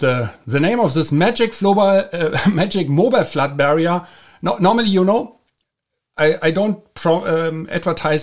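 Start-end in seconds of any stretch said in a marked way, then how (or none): none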